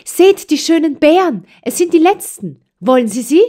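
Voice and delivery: put-on high, bright-eyed child offering berries; eager young girl. A narrator's reading. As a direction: plead sweetly like a little girl. (eifriges kleines Mädchen)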